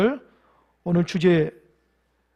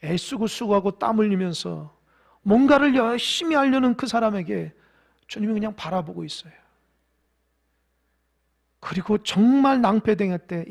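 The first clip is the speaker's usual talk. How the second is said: ordinary delivery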